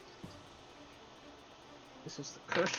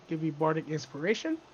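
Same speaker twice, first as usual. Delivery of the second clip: ordinary talk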